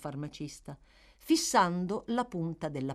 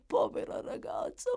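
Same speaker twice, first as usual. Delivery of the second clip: breathless high whisper of pity; whispered grief. Acted. Dying woman grieving the girl.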